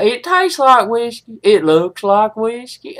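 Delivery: normal talking voice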